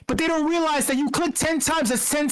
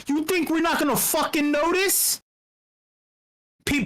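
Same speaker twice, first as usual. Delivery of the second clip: usual speaking voice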